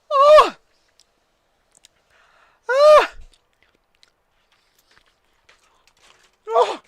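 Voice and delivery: high squeals from spicy heat; pained high yelps. Real, not acted. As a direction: yelp through the burning. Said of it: "Mouth is burning".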